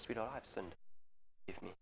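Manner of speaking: near-hushed, tender confession; soft apology to daughter. Retold or imitated. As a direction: gently ask a child's forgiveness. Father apologising to his daughter.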